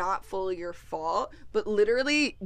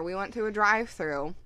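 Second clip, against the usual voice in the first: ordinary talk, nothing marked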